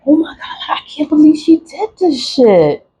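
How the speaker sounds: high, breathless whispered disbelief; shocked whisper. Retold or imitated. Recalling her own shock at betrayal, whispered disbelief. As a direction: whisper it as if stunned. Retold shock after a gasp.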